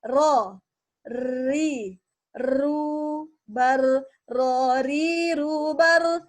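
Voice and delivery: "Ro", "Ri", "Ru", "Bar" with a buzzing tongue-tip r; syllable drill with a rolled R; trilled R drill. Shown as a demonstration. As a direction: roll every r.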